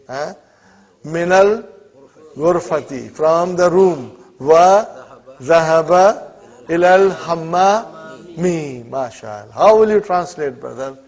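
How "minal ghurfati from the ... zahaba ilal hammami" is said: slow, loud reading of each word; slow drill reading. Shown as a demonstration. Teacher to students, reading the Arabic drill slowly and loudly. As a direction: read it out slowly for the class. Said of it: "reading the drill aloud".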